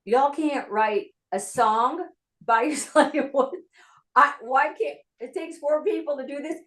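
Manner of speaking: high-pitched, mildly incredulous retort with a laugh; incredulous attitude. Retold or imitated. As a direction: act baffled at how they work. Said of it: "that was my attitude".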